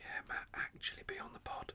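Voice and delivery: soft unvoiced whisper. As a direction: whisper along. Joins the whispering.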